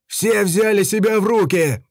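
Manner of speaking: high, loud, straining shout; straining yell. A narrator's reading. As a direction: yell at full strength. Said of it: "надрываясь, орал старшина".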